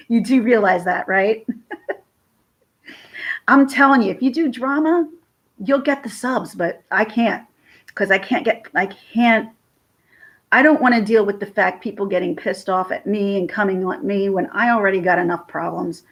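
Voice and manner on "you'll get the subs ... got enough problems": usual speaking voice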